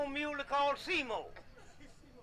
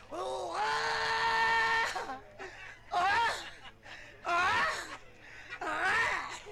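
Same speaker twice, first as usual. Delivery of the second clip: hollering hoop; 6 semitones higher, much slower than usual. Retold or imitated. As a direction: whoop out like a donkey driver hollering. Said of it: "And he give a hoop".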